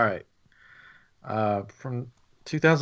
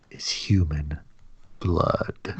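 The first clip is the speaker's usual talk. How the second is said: hushed, lower horror reveal; spooky whisper; 8 semitones lower, 4 dB quieter, much slower than usual. Acted. Storyteller revealing the chocolatier's secret ingredient, hushed whisper. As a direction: whisper the twist like a ghost story.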